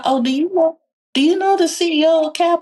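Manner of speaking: lightly hammed-up curious voice; mock-impressed asker. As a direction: play the wide-eyed person asking about the CEO. Retells people asking about her connections.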